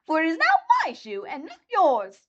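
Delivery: high, crackly witch voice, gloating; wicked witch. A narrator's reading. Witch gloating to Dorothy over the shoe, high voice. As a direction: crow over the stolen shoe.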